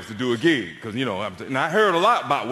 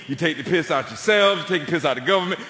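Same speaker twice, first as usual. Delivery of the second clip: ordinary talk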